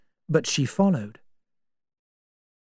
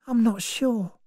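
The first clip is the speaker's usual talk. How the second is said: put-on young woman's voice; 9 semitones higher, 3 dB quieter than usual. Vera answers Lombard.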